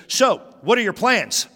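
high, loud imitation of mom asking; mom's voice. Son imitates mom demanding his plans, high voice.

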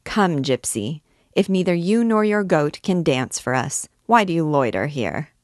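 ordinary delivery

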